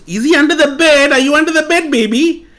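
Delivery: very high, loud playful calling; mother calling under the bed, high and loud; mock search. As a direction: pretend to search everywhere.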